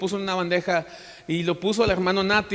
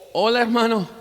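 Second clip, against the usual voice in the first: higher, put-on "Hola, hermano"; mock friendly greeting; 4 semitones higher than usual. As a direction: greet an enemy with pretend friendliness.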